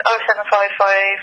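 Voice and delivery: ordinary talk, nothing marked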